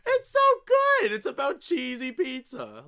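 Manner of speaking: very high, loud, stretched-out squeal of joy; overcome with delight. Real, not acted. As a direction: squeal it slowly, tickled to tears by a corny joke. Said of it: "am tearing".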